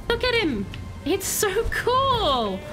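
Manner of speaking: ordinary talk, nothing marked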